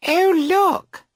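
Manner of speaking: high, bright surprised exclamation; giddy girls noticing the drifting boat. A narrator's reading. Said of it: "are surprised".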